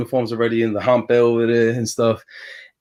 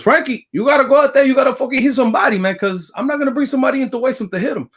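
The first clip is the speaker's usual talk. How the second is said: high, fast broken-English Cuban Spanish accent imitation; 14 semitones higher, 4 dB louder, faster than usual. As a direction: play Lou Piniella pushing him hard, in a thick Cuban Spanish accent. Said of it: "in his broken ass Cuban Spanish voice".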